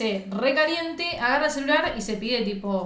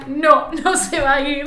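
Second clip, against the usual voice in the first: louder, drawn-out mock refusal; laughing dismay; 5 dB louder, slower than usual. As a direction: laugh through a dismayed change of mind. Reversal on seeing the price. (negación entre risas, alargada y fuerte)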